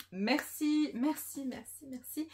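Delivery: high, loud, gushing merci; thrilled, almost squealing thanks; excited thanks. Real, not acted. Vlogger thanking the publisher for translating all the tomes, gushing high thanks.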